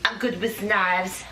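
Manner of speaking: put-on low, slow French accent. Doing Colette over in a French accent on request. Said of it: "again in a French accent".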